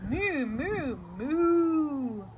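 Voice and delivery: loud, drawn-out moos; cow's moo. Acted. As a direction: moo out like a cow. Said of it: "the duck on the pond said".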